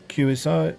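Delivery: ordinary delivery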